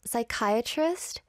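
usual speaking voice

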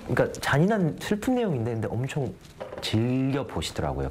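ordinary talk, nothing marked

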